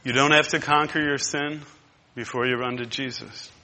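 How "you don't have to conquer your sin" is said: ordinary talk, nothing marked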